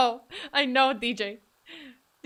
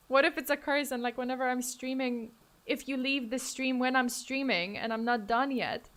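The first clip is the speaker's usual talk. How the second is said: ordinary delivery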